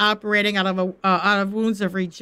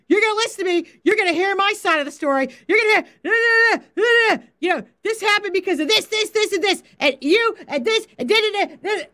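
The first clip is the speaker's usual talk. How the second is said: hounding husband through the door, pitched up; mock yelling at husband; 7 semitones higher than usual. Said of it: "yelling at him through the bathroom door".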